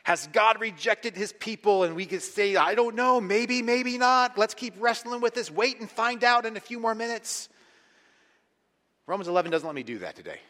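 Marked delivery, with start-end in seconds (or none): 0.0-1.8 raised, louder question left hanging; suspenseful. Shown as a demonstration. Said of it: "let's get suspense". 2.6-7.5 high, loud teasing uncertainty; mock suspenseful stalling; playing up suspense. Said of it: "let's get suspense".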